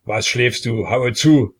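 loud, rising to a strong shout on Haue zu; tsar calling out. A narrator's reading. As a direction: bark the order at the executioner. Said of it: "rief der Zar".

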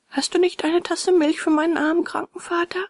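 put-on high boyish request; high, imploring boy's voice; pleading puppet boy. A narrator's reading. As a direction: ask earnestly in a high voice. Puppet boy asks for milk.

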